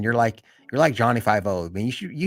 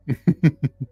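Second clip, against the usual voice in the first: laugh; 6 semitones higher, 6 dB louder than usual. Real, not acted. Loud laugh ending the stream chat.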